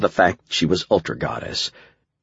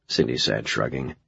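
usual speaking voice

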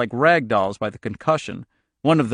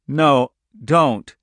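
understated plea, a bit louder; almost conversational; 5 dB louder than usual. A narrator's reading. Al in terror before Charlie, almost conversational. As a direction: say it simply, with two separate beats. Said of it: "in an almost conversational tone of voice".

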